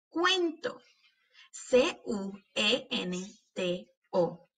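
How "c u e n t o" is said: slow, letter by letter; name each letter separately; spelling out. Shown as a demonstration. Teacher spelling cuento for pupils, letter by letter. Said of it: "cuando estamos deletreando estas palabras".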